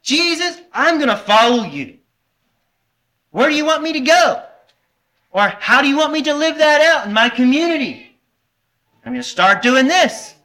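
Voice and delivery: high, fast, eager promise; eager disciple. Retold or imitated. Preacher voicing himself as an eager disciple, excited. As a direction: gush your devotion to Jesus. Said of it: "It's like".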